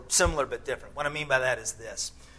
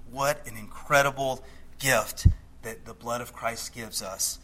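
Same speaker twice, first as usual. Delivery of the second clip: normal talking voice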